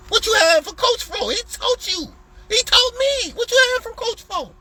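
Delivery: high, mocking squeal of a whiny client; mocking squeaky voice. Mocking ungrateful client questioning.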